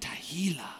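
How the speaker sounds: Tehillah breathed out in a soft whisper; whispered word. Shown as a demonstration. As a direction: savor Tehillah in a hush. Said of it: "which is just so fun to say".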